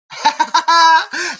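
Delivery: high happy laugh. Real, not acted. Presenter laughing at the dance break, happy laugh.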